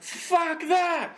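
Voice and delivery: high raspy shout imitating Carlin; raspy George Carlin. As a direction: rasp it out like an angry old comic. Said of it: "super raspy voice".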